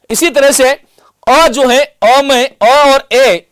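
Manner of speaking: अ and ए drawn out slowly, each vowel held apart; slow vowel demo. Shown as a demonstration. Teacher slowly sounding out vowels for students. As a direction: stretch अ and ए slowly so students hear the difference. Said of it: "इसको भी करके देखिएगा".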